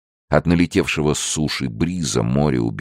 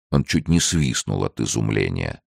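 usual speaking voice

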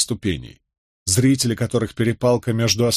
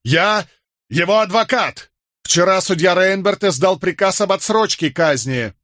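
high, insistent declaration; urgent shout; 8 semitones higher than usual. A narrator's reading. Lawyer to a soldier blocking his way, urgent shout. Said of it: "крикнул я".

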